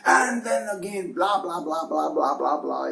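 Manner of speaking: high-pitched mock rant, falling as it goes; imitated angry shouting. Boss resuming his rant at an employee, mock shouting. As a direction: jump up high into a pretend tirade of blahs. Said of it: "He was continued with his shouting".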